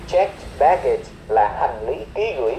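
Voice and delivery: normal talking voice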